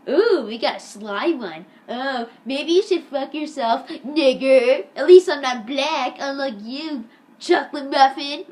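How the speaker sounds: loud, drawn-out jeering comeback; mock taunting. Eric answers Jake in a quarrel, imitated loud taunt. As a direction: imitate a kid goading his rival, slow and loud.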